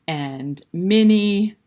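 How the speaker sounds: plain reading voice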